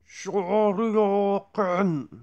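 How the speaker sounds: loud, slow, stretched shout; special move shout. Retold or imitated. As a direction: bellow the move name. Street Fighter move shout.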